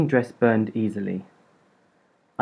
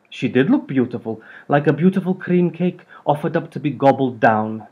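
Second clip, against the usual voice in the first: wry Hungarian grandmother; Hungarian accent; 3 semitones higher, 3 dB louder than usual. A narrator's reading. Hungarian gran mocking the cream cake dress, accented. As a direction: savour the insult in a Hungarian accent. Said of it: "held on to her accent".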